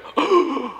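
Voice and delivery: a high, startled intake of breath; sharp gasp. Retold or imitated. Disciples at the table recognizing Jesus, a sharp gasp. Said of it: "and they go".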